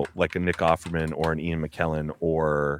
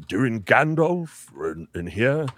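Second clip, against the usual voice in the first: Gandalf voice; 3 semitones higher, slower than usual. Retold or imitated. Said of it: "Ian McKellen, obviously".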